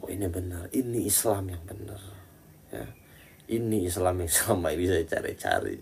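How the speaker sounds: awed hushed follower. Retold or imitated. A follower marvelling quietly at the false preacher. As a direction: breathe it like someone just hypnotised. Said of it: "yang nggak tahu ya pasti terpukau".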